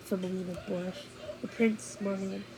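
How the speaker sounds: ordinary delivery, nothing marked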